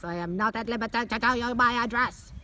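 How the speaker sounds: mock scream down the phone; retold screaming. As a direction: shout it like the irate man on the phone. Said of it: "He's screaming at me".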